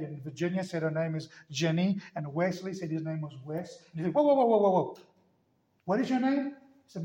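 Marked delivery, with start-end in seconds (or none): 4.1-5.0 higher, louder run of whoas; startled interruption. Retold or imitated. Zulu man stops him in disbelief. 5.8-6.6 high-pitched mock disbelief; incredulous question. Zulu man asks again, taken aback.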